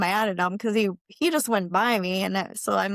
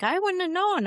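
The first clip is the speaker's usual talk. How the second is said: Lauren's surprise; 11 semitones higher than usual. Retold or imitated. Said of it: "And Lauren's like".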